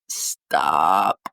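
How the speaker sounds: very low, strained, cracking plea; choked-up stop. Real, not acted. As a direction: push the word out through a tight throat. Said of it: "I'm, like, a little emo".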